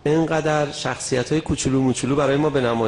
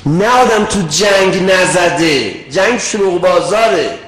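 louder, slow and insistent; raised preaching voice; 4 semitones higher, 9 dB louder, slower than usual. Real, not acted. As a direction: drive each word home with force.

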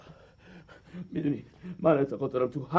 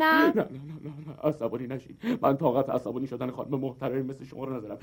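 softer and lower, sweet-talking her down; soothing; 6 semitones lower, 4 dB quieter than usual. Acted. Biker sweet-talks the angry driver, softly. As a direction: coax her gently to calm down.

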